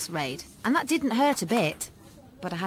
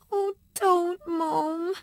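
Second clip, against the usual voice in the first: high, tearful plea; a child beginning to cry; starting to cry; 10 semitones higher, 3 dB louder, much slower than usual. A narrator's reading. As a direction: voice cracking into tears. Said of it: "starting to cry too".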